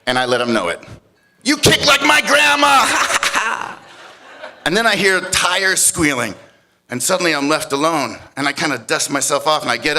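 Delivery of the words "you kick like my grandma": high, loud jeer ending in laughter; mock him at the top of your voice; shouted taunt. Pinned storyteller jeering at his kicker, shouted taunt.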